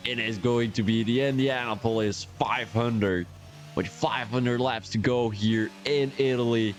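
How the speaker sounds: light American accent, hammed-up race commentary; mock American commentator. Streamer commentating the race in a mock American accent. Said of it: "frustrated with my accent".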